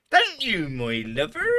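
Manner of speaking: loud, high-pitched Cornish chip shop lady; Cornish accent. Retold or imitated. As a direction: imitate a Cornish woman, swooping high and loud. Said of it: "I could do a sort of Cornish accent".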